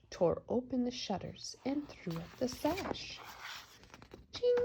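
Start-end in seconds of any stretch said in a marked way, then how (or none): 0.1-3.2 whispered story line. Acted. Mother reading to her child, whispered for suspense. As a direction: hush it like a secret.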